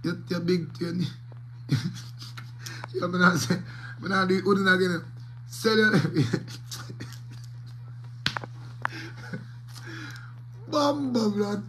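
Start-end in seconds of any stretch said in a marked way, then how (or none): 1.0-2.9 real wheezing laugh; breathless laugh. Real, not acted. Can't stop laughing mid-ad. 6.0-10.6 real wheezing laugh; breathless laugh. Real, not acted. Can't stop laughing mid-ad.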